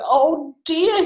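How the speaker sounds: high, loud mock dismay; alarmed owl. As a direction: exclaim in storybook fright. Owl frightened by Gruffalo.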